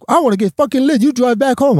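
high, eager party voice; mock drunk friend. Friend telling the narrator he wants to get drunk, high and eager. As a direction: play the friend keen to get lit.

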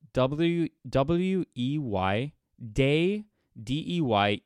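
W E Y spelled letter by letter, slow; spelling out slowly. Shown as a demonstration. Decoding Pidgin spelling for listeners, slow spelling. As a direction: spell W E Y out deliberately. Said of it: "they spell everything wrong".

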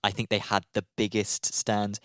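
normal talking voice

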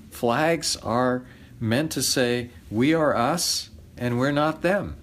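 ordinary talk

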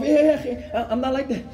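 high, comic squirming protest; mock-uncomfortable, laughing refusal; awkward brush-off. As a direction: fend off an over-tight hug. Acts fending off a tight hug.

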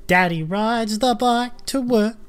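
put-on slow, sing-song kids teacher; kids show host voice. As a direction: lightly mimic a toddler show host. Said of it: "how close am I".

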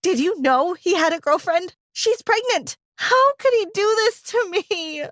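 high, distressed wail; cried. Acted. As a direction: cry it out in disbelief. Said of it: "I cried".

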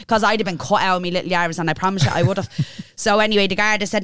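ordinary talk

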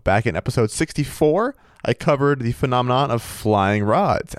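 ordinary talk, nothing marked

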